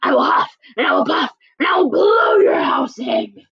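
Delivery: loud wolf threat, building to a shout; threatening wolf. Acted. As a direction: bellow it, rising on the last part. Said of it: "growled the wo-, wolf".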